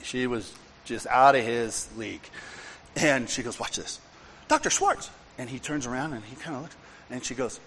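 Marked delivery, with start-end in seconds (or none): 3.6-4.0 quiet aside. Retold or imitated. Cindy whispers again. 4.5-5.1 calling out. Retold or imitated. Cindy calls out to psychiatrist.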